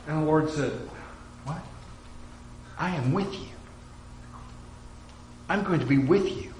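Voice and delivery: softly, confiding with the congregation; quiet preaching. Acted. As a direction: drop to a hushed heartfelt tone. Quiet intimate preaching stretch.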